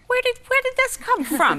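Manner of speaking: the daughter baffled by her atheist father's scripture-quoting, asking in high astonishment; amazed disbelief. Retold or imitated. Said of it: "And I was like".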